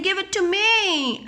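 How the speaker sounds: put-on high, demanding child voice; whiny child wanting the toy; children grabbing. Children demanding the toy, in a high grabby voice. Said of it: "started saying".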